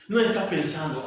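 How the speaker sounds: usual speaking voice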